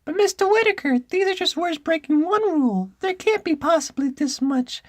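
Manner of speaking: very high squeaky schoolboy; loud, high-pitched boy character. Acted. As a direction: protest to a teacher in piercing falsetto. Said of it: "I gotta do a boy voice".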